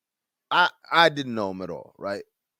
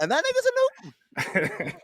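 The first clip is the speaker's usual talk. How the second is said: high, slow mocking quote; hammed-up high-pitched impression; slower than usual.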